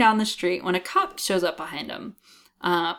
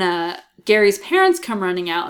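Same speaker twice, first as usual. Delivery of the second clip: normal talking voice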